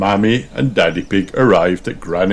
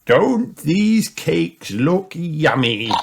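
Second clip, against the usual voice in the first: slow, raised, relishing grandpa voice; Grandpa Pig; 10 semitones higher, much slower than usual. A narrator's reading. Grandpa admires the cakes, slow lifted grandpa voice. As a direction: stretch it out high and slow.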